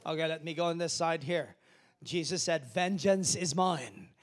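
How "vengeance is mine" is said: slow, loud, heavy Vengeance is mine; slow loud quote. Acted. Pastor quoting God to the church, slow and loud. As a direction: intone it with force.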